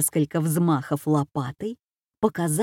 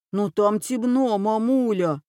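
higher, put-on whiny voice; whining son; 3 semitones higher than usual. A narrator's reading. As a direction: whine to mother about the dark. Oafish son whines to mother. (ноющий маменькин сынок)

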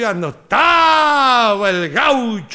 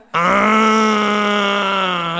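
mock dismay. Gaucho's shocked realisation.

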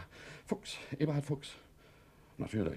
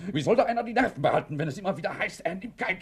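loud, sharp, nerves fraying; angry outburst; 5 semitones higher, 7 dB louder than usual. Acted. Husband cuts off his nagging wife with a loud angry outburst. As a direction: bark it at her to stop.

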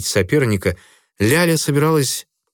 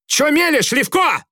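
high, fierce scolding cry; furious shout. A narrator's reading. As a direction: snap back at him furiously. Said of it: "яростно крикнула Ляля". (яростный крик)

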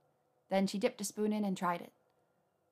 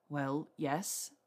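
muffled, face buried in arms; 5 semitones lower than usual. A narrator's reading. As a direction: speak into your arms, muffled. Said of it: "he said in a muffled voice".

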